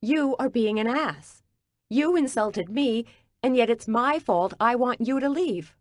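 indignant pregnant woman snapping back at Gordon. A narrator's reading. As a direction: let the anger lift the pitch. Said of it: "my voice rising".

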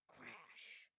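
usual speaking voice

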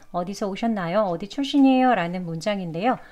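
ordinary talk, nothing marked

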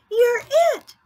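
very high, loud playground cry. Acted. Cod tags a playmate in the playground, crying out. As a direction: cry it out like a child playing tag. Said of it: "cried a cod".

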